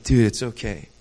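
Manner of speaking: soft and low, gently comforting; tender reassurance. Retold or imitated. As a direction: hold them close with your voice. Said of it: "and be like".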